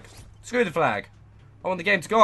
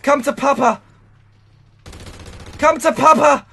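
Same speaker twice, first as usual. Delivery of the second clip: rising higher and louder each time; building pleading call; 9 semitones higher, 7 dB louder than usual. Real, not acted. Gamer luring enemies for his last kills, building excitement. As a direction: coax the enemies in, more eager each time.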